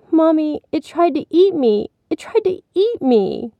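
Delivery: put-on high child's voice, pleading alarm; frightened little boy. A narrator's reading. Billy telling mommy of the monster, frightened.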